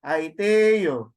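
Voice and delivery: "Aiteo" with a loud, lengthened te, then falling; accent on te. Shown as a demonstration. Teacher repeating the accented word, te stressed in Aiteo. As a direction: lean hard on the te of "Aiteo". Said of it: "where the pronunciation rises".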